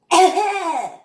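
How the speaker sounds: a sharp, high fake cough burst; imitated cough. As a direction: bark out her cough like she's choking on the smoke. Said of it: "and she goes".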